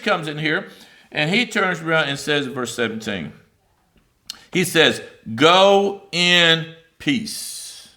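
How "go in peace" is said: very slow, drawn-out "Go in peace"; slow blessing. Acted. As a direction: stretch every word of "Go in peace". Priest's blessing read aloud.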